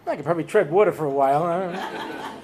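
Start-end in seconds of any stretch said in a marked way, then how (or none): none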